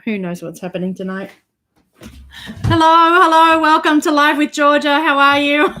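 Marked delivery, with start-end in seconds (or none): 2.6-5.7 upbeat host hello to viewers; bright show greeting. Real, not acted. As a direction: open the show big and cheery. Opening live show greeting.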